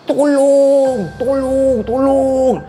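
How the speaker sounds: high, loud desperate screams; screaming for help. Acted. Mother outside the house screaming to neighbours for help. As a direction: scream for help with all your strength. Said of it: "Dia jerit sekuat hati".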